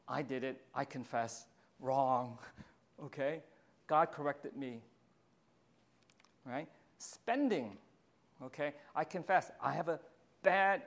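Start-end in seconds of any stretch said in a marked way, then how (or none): none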